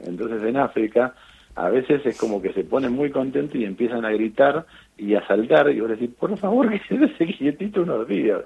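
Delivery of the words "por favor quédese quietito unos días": high-pitched plea breaking into laughter; laughing mock plea. Doctor begs a celebrating patient to keep still, laughing plea.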